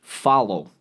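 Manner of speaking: ordinary delivery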